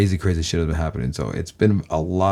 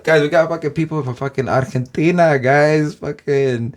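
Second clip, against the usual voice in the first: put-on Spanish accent; 7 semitones higher, 4 dB louder, slower than usual. Spanish-accented English shout-out.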